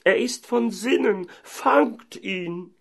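high, alarmed mayor's cry; beside himself. Acted. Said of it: "rief der Bürgermeister ganz außer sich". (außer sich rufen)

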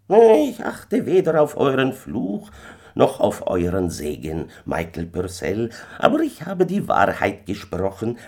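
high-pitched, imperious little stranger; kleiner Fremder. A narrator's reading. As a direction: brush off the curse as the uncanny little man. Stranger answers the curse. (gebieterischer kleiner Fremder mit hoher Stimme)

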